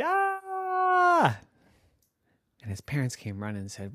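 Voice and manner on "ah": high startled yelp of Ah; a sudden high cry of shock; scream. Acted. Boy finding his covers gone, high startled scream. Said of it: "And he screamed".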